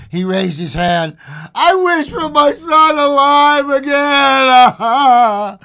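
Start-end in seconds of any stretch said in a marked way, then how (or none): 1.5-5.6 high, loud, very slow cry; anguished shout. A narrator's reading. Husband wishing on the paw, anguished slow shout. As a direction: shout the wish in drawn-out anguish. Husband makes the dreaded wish aloud.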